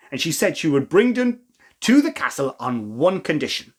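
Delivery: usual speaking voice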